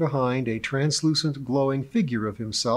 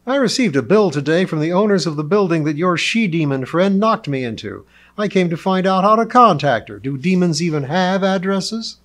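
loud, raised-pitch angry complaint; indignant demand; 6 semitones higher, 7 dB louder than usual. A narrator's reading. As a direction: confront them loudly, demanding answers. Lionheart angrily confronts his hosts.

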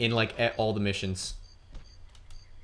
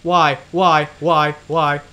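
loud, higher repeated protest; real gamer exasperation, easing slightly; exasperated cries; 4 semitones higher, 9 dB louder, much slower than usual. Real, not acted. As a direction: fire out each one in frustration. Frustrated during the game.

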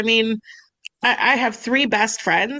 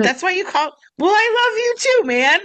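mock touched surprise; 10 semitones higher than usual. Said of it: "the double take to be like".

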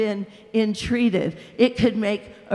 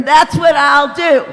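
loud, higher defiant threat; defiant shout; 6 semitones higher, 11 dB louder than usual. A narrator's reading. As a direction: threaten like a sulking child. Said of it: "it repeated".

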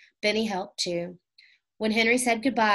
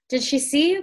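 higher and louder, eager and alarmed; excited cry; 7 semitones higher, 6 dB louder than usual. A narrator's reading. Jessie asks her brother about the cook, crying out. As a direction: blurt it out, wide-eyed. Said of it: "cried Jessie".

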